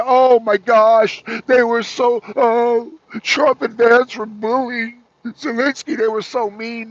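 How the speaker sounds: drawn-out mocking lament, mimicking the media; slow, whiny imitation of shocked commentators; mock dismay. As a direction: exaggerate the pearl-clutching outrage.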